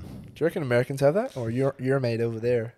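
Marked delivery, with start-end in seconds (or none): 1.5-2.7 "your mate over there" in a mock American accent. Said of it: "not a bad American accent".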